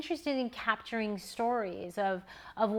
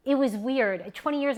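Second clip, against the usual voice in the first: ordinary talk, nothing marked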